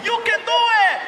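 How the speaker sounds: very high, loud yell of encouragement; cheering onlooker. Acted. People in line urging on the big rider, high cheer. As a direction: shriek it like a cheering bystander.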